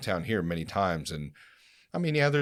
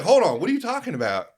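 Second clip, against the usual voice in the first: high, raised-voice protest; replayed incredulous objection, pitched up; retold indignant challenge; 12 semitones higher, 7 dB louder, faster than usual. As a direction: challenge him in disbelief, as you retell it. Retold confrontation with pamphlet man.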